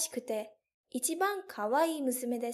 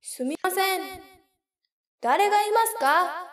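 raised, higher call into an empty castle; calling out loudly; 4 semitones higher, 6 dB louder than usual. Acted. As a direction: call out to whoever is inside. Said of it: "大きい声で言いました".

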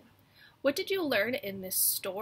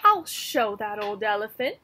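defiant mouse; 8 dB louder than usual. Acted. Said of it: "said the mouse".

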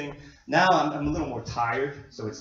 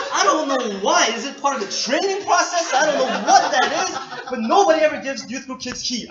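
laughing disbelief; 6 semitones higher, 6 dB louder than usual. Real, not acted. Laughing complaint about no heat.

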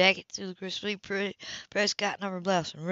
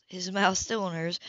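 ordinary delivery

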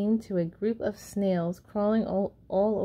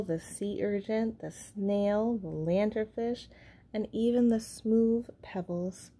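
usual speaking voice

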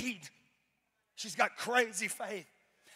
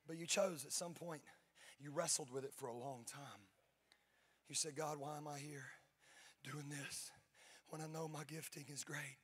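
much softer, intimate and low; tender personal address; 4 semitones lower, 7 dB quieter than usual. Acted. Pastor quietly affirming Natalie's calling in front of church. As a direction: speak gently, just to her.

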